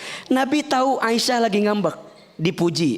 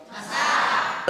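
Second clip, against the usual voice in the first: berbisik; 6 semitones higher, 4 dB quieter than usual. Acted. Aisyah menjawab sambil masak.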